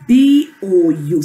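"B O U" in separate, measured letters; spelled out. Shown as a demonstration. Teacher drilling the class in spelling, "B O U" letter by letter. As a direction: chant "B O U" one letter per beat. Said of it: "So it's B O U".

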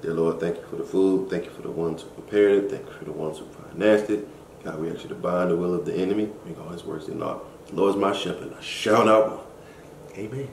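quiet earnest prayer voice. Real, not acted. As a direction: pray sincerely over the food. Blesses the food.

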